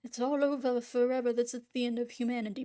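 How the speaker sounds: mock despair, as if declaring the apocalypse; mock doomer. Refusing to go all doomer, mock doomer lament. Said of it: "I'm not gonna go all doomer".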